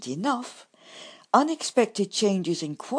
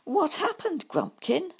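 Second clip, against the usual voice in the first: "What happened" asked high and anxious; worried badger question; 10 semitones higher than usual. A narrator's reading. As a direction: fret over the fallen friend. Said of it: "exclaimed Bumble".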